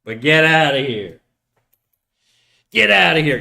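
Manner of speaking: loud, playful "scram" shout; mock banishing. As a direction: shoo them away with mock toughness. Mock-banishing an underage viewer.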